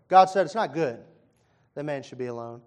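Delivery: ordinary delivery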